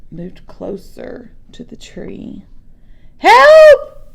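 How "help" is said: sudden high, loud cry for help; desperate call for help. Acted. Scared boy calls for help from the bear. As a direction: scream out to be rescued. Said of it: "Louis tried to call".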